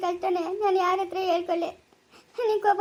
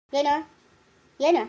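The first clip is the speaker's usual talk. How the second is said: usual speaking voice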